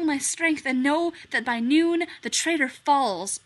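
loud theatrical verse; declaiming Hamlet. Acted. Hamlet declaiming to the stranger, loud and theatrical. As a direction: proclaim it from the stage.

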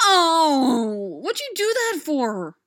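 loud, high pained howl. A narrator's reading. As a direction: yelp like you've just been hurt. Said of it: "Boyle howled".

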